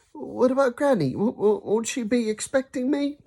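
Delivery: put-on high, worried boy's voice with a stammer; anxious. Ben worrying to his dad about Granny, high and anxious. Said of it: "said Ben anxiously".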